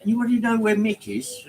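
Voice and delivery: high, lightly voiced enquiry in the chat; a hint of a worried regular calling out; asking around. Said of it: "live streams I went in and said".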